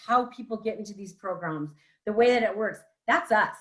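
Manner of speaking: ordinary talk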